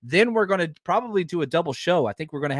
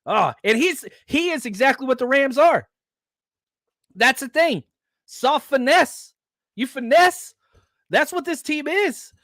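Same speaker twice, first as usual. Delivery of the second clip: loud, higher angry rant; getting mad; 10 semitones higher, 3 dB louder than usual. Real, not acted. Fan ranting at rival Rams, real heated yelling. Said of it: "I'm getting mad".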